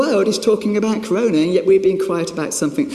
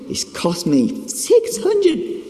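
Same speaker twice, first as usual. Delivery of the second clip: hundred stretched out very slowly and much higher; emotional stress on hundred; 10 semitones higher, much slower than usual. Real, not acted. To the congregation, lamenting the repair bill with drawn-out hundred. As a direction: drag out hundred in disbelief. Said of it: "you can see that I'm quite emotional".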